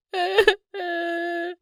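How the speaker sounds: high acted crying, trailing on; childlike wailing sobs. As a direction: keep sobbing like a little cub. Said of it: "कियोन बहुत रोता है". (बच्चे की तरह ऊँचे स्वर में रोना)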